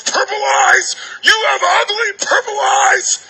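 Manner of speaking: high-pitched mock kid's taunt, drawn out at the end; screaming little kid. Little kid comes up and taunts a stranger, mock screaming. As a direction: wail it like a bratty child. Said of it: "if they scream at you".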